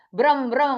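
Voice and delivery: punchy spoken motor mimicry; jet ski imitation. Shown as a demonstration. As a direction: rev it like a jet ski, with force. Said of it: "Gimana bunyinya jet ski kira-kira".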